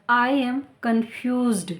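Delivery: very slow, each word separate; slow careful demo. Shown as a demonstration. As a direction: say it slowly so they can copy. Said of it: "ध्यान से सुनिए".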